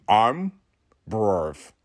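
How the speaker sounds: "brave" bent toward "brorv" with one tooth; a touch of toothless mumble; Monko's voice. Shown as a demonstration. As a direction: lightly mouth it as a toothless guru. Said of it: "That's not what he sounds like".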